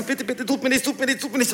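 high, rapid, small-voiced begging; frightened pleading. Prisoner cowering in the cell corner, scared pleading. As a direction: beg in a hammed-up panic. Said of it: "Ich hatte Angst".

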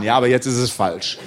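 a hint of a strict teacher; lightly voiced stern teacher. Teacher pupil dialogue. (etwas tiefer, strenger Lehrer)

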